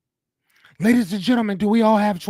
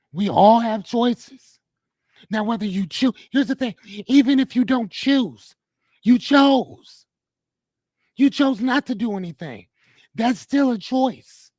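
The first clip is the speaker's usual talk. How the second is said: preaching; 6 semitones higher than usual. Acted. Preaching the main point.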